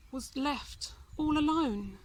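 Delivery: ordinary delivery, nothing marked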